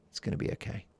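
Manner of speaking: soft, breathy reassurance to a partner; gently, almost under the breath. Retold or imitated. The guy soothing his upset girlfriend, softly. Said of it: "She just wanted the guy to say".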